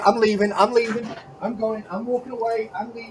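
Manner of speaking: playful sulky retreat, fading out; fading mock exit. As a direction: pretend to walk off in shame. Mock leaving in guilt.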